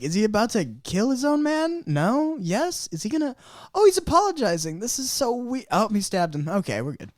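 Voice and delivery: mock nervous surprise. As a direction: flip between fear and relief. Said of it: "You're like".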